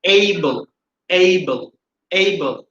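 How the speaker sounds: Able with a gliding "ay-ee" first vowel; correct pronunciation. Shown as a demonstration. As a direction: model Able as "AY-bul", falling at the end. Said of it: "তাহলে এটার উচ্চারণ কি হবে".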